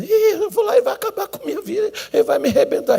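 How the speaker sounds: squeaky laughing mock fright, voice jumping high; hammed-up dread of the stern pastor; mock fear. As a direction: play the scared man, half laughing. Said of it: "retold fearful brother".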